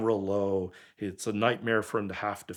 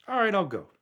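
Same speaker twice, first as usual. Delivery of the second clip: a high, loud eager agreement; eager yes; 11 semitones higher, 5 dB louder than usual. Retold or imitated. Agreeing to drive the car to New York, high and eager. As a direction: jump at the offer.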